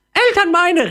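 very high loud joyful parrot call; excited parrot. Acted. Parrot calling to its parents, very high and excited. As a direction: shout it out like an overjoyed parrot. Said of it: "rief der Papagei aufgeregt".

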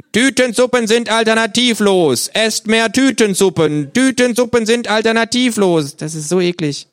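very high, loud mocking kangaroo; mock him and chant the slogan. The kangaroo mocks the narrator and parodies soup propaganda, high and loud.

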